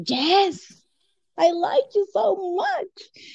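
high, drawn-out gushing reply; eager yes. Retold or imitated. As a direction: imitate an eager, smitten yes. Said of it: "I was so ready to say".

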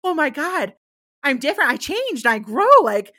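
delighted. Retold or imitated. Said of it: "I'm like".

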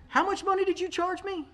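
high mock-outraged question; mock outrage at the bill; indignant. Indignant aside at surgeon.

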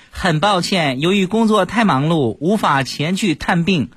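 high, measured letter-reading voice; nurse reading aloud. Acted. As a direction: formally declaim a letter to a patient. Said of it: "护士当时就念了".

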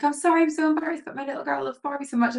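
high, fast mock-emotional apology; imitated tearful apology. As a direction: apologise in an embarrassed rush, retold. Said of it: "So she started crying".

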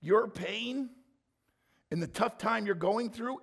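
normal talking voice

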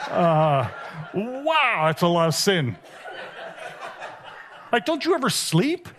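Jesus cracking up at the pile of sin; laughing with mock astonishment. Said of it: "I always imagine Jesus going".

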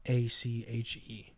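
very slow, separated letters; spelling out. Real, not acted. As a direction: spell it out unhurriedly, letter after letter. Spells an artist's name out.

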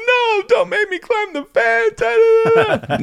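very high, drawn-out mock sobbing wail; hammed-up toddler bawling, stretching the words out; crying child. Three-year-old pleads with mom about the fence, bawling.

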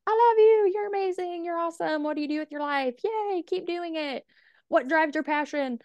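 over-the-top delighted fan greeting; gushing golden retriever. Retold or imitated. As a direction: gush like an overeager puppy meeting strangers. Gushing at people she meets.

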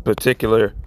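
ordinary talk

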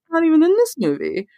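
high-pitched mock disbelief; retold disbelief. Host retelling finding the actor absent, high-pitched disbelief.

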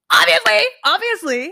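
high, loud exclaimed quote; emphatic retort. Acted. Acting out a reply to a Snapchat message, emphatic retort.

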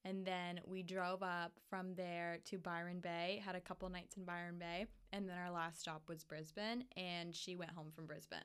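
mellow, spaced out, trailing off; stoned. Real, not acted. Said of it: "I'm fucking so baked right now".

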